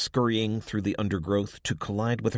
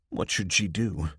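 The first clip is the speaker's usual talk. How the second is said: ordinary delivery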